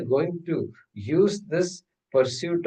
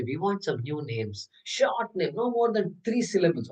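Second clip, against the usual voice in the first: higher-pitched mock enthusiasm; over-enthusiastic parents; 5 semitones higher than usual. Parents asking for short new baby names, over-enthusiastic. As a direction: play keen parents demanding new names. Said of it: "became little over-enthusiastic and say".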